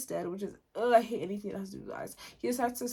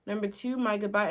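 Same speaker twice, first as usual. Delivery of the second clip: ordinary delivery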